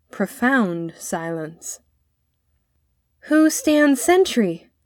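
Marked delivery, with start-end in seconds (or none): 3.2-4.7 high, slow challenge; drawn-out hail, pitched up; calling out. A narrator's reading. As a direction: call the question slowly toward a distant gate. Boy calls to the gate.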